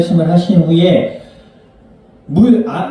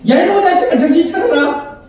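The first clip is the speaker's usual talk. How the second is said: very high-pitched scolding mom voice; angry mother; 12 semitones higher than usual. Acted. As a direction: act out an exasperated mother telling off her child. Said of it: "엄마가 화를 내요". (화난 엄마 흉내, 높은 목소리로 야단치듯)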